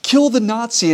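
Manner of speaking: high, loud imitation of a crowd shout; retold yell. Somebody in the crowd spots this guy, retold yell. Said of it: "he yells out".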